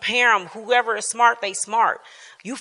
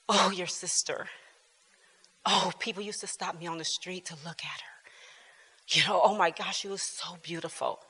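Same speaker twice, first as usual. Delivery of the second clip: hushed, whispery imitation of mom; breathy gushing mom, said quietly; soft mock-admiring mother's voice; imitating mom; 3 semitones lower, 12 dB quieter than usual. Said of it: "That's how she talked about my sister".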